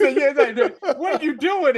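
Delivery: high, loud laughing retelling. Real, not acted. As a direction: crack up while quoting them. Laughing while retelling X-Men reaction.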